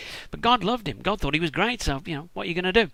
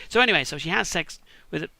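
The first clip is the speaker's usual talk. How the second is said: ordinary talk, nothing marked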